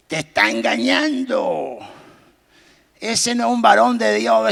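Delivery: a lighter devil voice, a bit slow; whisper-like tempting tone, only lightly voiced. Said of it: "Satanás le decía". (voz del diablo suave)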